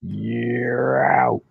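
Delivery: long, drawn-out umpire call; hammed-up umpire holler; umpire yelling out. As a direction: rise in pitch on a stretched call. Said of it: "as the umpire yells out".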